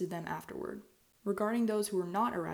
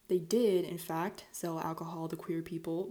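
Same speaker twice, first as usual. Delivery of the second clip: normal talking voice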